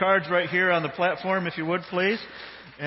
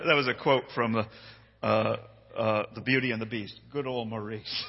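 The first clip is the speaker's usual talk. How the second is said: ordinary talk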